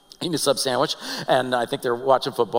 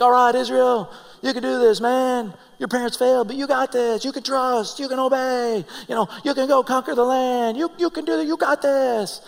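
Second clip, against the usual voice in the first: high-pitched motivational pep talk; inspirational speaker; 9 semitones higher than usual. Acted. Moses pumps up Israel to conquer the land, inspirational speaker. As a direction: cheer them on like a hype coach. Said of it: "kinda sounded like an inspirational speaker".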